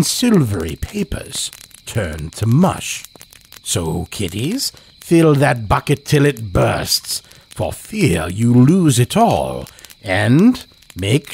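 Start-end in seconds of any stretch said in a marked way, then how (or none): none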